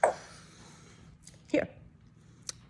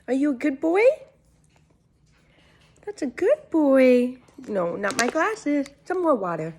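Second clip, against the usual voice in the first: talking to a dog; 3 semitones higher than usual. Real, not acted. Cooing to her pet bird.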